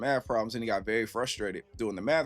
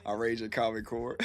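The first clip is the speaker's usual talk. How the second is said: ordinary talk, nothing marked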